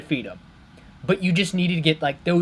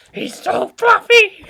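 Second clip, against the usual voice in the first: very high, loud, slow squeal building to a shout; cuteness squeal; 7 dB louder, slower than usual. Real, not acted. Fan overwhelmed by a fluffy monster, shrieking squeal. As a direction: squeal like it is too cute to bear.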